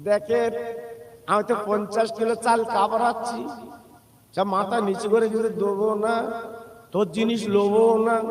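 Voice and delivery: ordinary delivery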